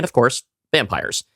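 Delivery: usual speaking voice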